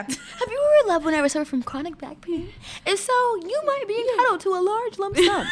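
loud, high announcer pitch; infomercial. Acted. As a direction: sell it like a late-night TV ad. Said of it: "We'll do a commercial".

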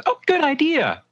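put-on the girl lights up at the idea; excited little girl. The little girl answers the player, eager and high-pitched.

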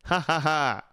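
short real ha ha ha laugh. Real, not acted. Vlogger laughing at being a Jaguars fan. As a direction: chuckle at your own joke.

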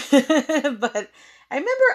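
हँसते हुए; laughing. Real, not acted.

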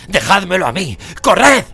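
high, full-force yell; loud commands, building to the end; urgent shout. A narrator's reading. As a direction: take charge with a desperate yell. Sirius orders them to flee.

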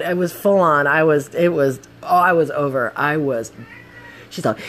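usual speaking voice

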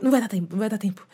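gabbled fast like a panic; rushed. Retold or imitated. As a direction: rattle it off in a hurry. Mimicking São Paulo rush.

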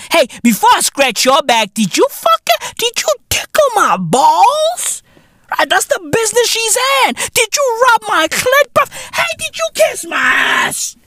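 very high, squealed questioning; mock incredulous demands. Music business demanding favours, high-pitched mock outrage.

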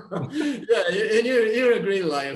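loud, amused tease through laughter; laughing. Real, not acted. Former student teases his teacher as a great liar, laughing. Joking reply to compliment, amid laughter.

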